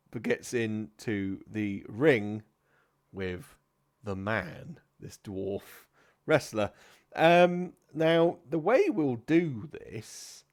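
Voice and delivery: ordinary talk, nothing marked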